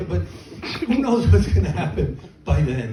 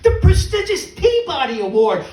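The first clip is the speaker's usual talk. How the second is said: ordinary talk, nothing marked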